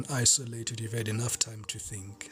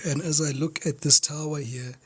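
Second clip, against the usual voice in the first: normal talking voice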